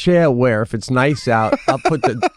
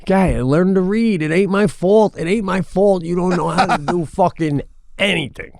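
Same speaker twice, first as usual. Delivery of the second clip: higher, exasperated scolding of a dog; talking to the dog; 7 semitones higher than usual. Retold or imitated. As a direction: chide the dog like it should know better. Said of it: "I've said to my dog, like".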